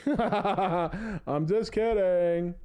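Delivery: laughs through it, high and slow; laughing it off. Real, not acted. Streamer laughing off his own joke to chat, laughing. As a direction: giggle the words out after a cheeky joke.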